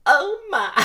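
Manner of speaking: loud, very high Southern falsetto; put-on Southern lady voice; southern belle. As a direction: swoon in a hammed-up Southern accent. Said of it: "It got southern".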